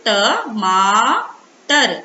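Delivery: ट, मा, टर slowly, each apart, मा held long; syllable by syllable. Shown as a demonstration. Teacher showing children how to read ट, मा, टर, syllable by syllable. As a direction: sound out ट, मा, टर with a stretched मा. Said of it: "इसको किस तरह से पढ़ेंगे".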